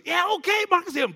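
high, loud eager yes; eager agreement. Retold or imitated. Oliver agrees to pray over Marcus, loud eager cry. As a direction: jump in ready to pray.

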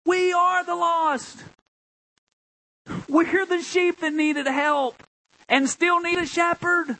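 high, drawn-out proclamation; full-force preaching. Real, not acted. Pastor proclaiming rescue to the congregation, full-force preaching. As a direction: preach it high, holding the key words.

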